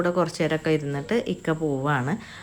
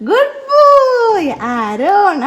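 drawn-out praise; 9 dB louder, much slower than usual. Real, not acted. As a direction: sing the praise out long and delighted. Praising toddler Aaron.

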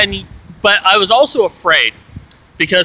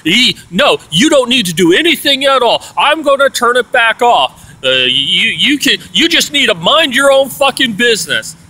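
ranting; 7 semitones higher than usual. Retold or imitated. Roommate telling him to mind his business, mock rant. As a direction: tell him to butt out.

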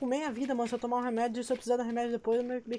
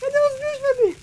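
high, loud alarmed cry; frantic question, pitched up; panicked; 6 dB louder than usual. Real, not acted. Monsters coming.

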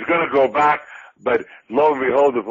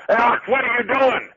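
excited call; 9 semitones higher than usual. Retold or imitated. Retold promoter calling him.

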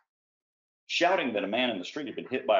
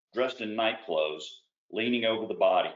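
usual speaking voice

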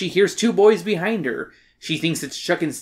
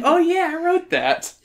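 mock realization; 13 semitones higher than usual. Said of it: "and I'm like".

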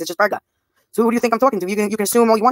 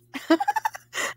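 a very high, breathy giggle; 7 dB quieter than usual. Real, not acted. Giddy over twin flame.

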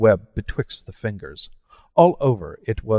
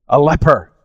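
loud, higher shout of horror; horrified cry; 5 semitones higher, 8 dB louder than usual. A narrator's reading. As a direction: blurt it out in terror. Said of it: "he cried".

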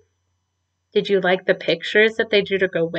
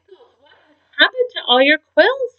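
trembling voice; 10 semitones higher, faster than usual. A narrator's reading. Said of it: "she asked with a trembling voice".

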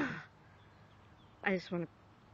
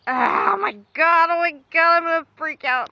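shouting in real disgust, higher and louder; real freak-out shout; 8 semitones higher, 10 dB louder than usual. Real, not acted. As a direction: yell it out, genuinely grossed out. Grossed out by maggoty mouse.

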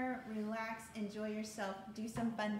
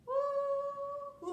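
long, high sung woo; high whoop; 13 semitones higher, 4 dB louder than usual. Real, not acted. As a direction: let out a sustained whoop after singing. Whoop after finishing the song.

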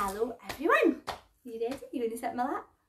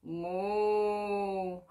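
cow moo; 6 semitones lower, 4 dB quieter than usual. Pretend. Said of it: "What does the cow say?".

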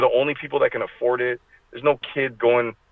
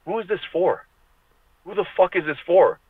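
exasperated disbelief; 8 semitones higher than usual. Retold or imitated. Exasperated rant at comic prices.

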